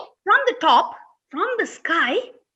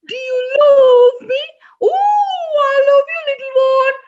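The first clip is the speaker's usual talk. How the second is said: high loud cooing to the little one; sing-song high animal voice; high storybook animal voice; 10 semitones higher, 7 dB louder than usual. Acted.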